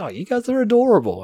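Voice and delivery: cooing high at something cute; mock cooing. Hearing a Kiwi accent, cooing adoringly and patronizing. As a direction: talk down to them like babies. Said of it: "it's like".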